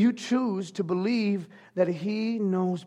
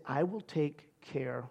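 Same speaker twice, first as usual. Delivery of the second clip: soft, slow, lower reassurance; hushed and tender, slow; gentle voice of God; 8 semitones lower, 11 dB quieter, slower than usual. Acted. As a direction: speak it like a tender promise. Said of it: "He said".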